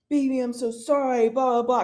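fake-blubber a pleading apology; mock sobbing. Girlfriend mimics her cheating boyfriend's apology, mock sobbing. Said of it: "just, like, sobbing".

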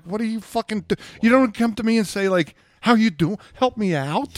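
loud, high, fed-up retold yelling; exasperated yell. Scolding the woman who ignored him, loud exasperation. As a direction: let the frustration burst out loud. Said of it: "I was just like".

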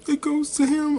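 high, loud mock gasp of emotion; gasp, then squeal it like a moved awards presenter; imitating tearful surprise. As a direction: overcome presenter, hammed-up and high-pitched. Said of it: "cried a little bit for him".

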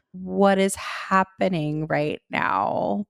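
very slow, drawn-out question; slow-motion bewilderment; stunned disbelief. Retold or imitated. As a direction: stretch out each word, baffled. Retold stunned reaction to stranger.

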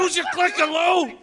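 yelled order across the room; shout. Acted. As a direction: shout it at him.

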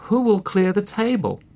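poor hen asking, pitched well up; little red hen. A narrator's reading. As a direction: call out high for a helper. Hen voiced asking for help.

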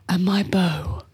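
hushed, whispery film-hero pledge; low, whispered actor impression; Orlando Bloom. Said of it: "You do your Orlando Bloom impression".

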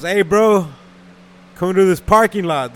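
loud call across traffic. Retold or imitated. Calling out to rival from car.

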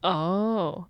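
ordinary talk, nothing marked

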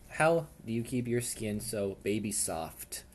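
ordinary talk, nothing marked

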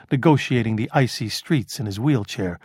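ordinary delivery

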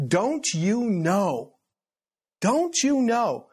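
slow, rising exasperation; exasperated rebuke. Acted. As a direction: drag it out, shaming them. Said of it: "six times he says".

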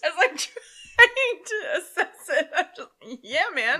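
high, slow, laughing words. Real, not acted. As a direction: keep laughing while talking. Still laughing.

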